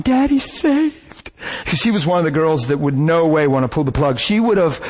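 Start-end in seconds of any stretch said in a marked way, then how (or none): 0.0-1.3 much higher and slow, choked "Daddy's saved"; tearful joy. Retold or imitated. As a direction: say it through tears of relief. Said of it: "she's crying".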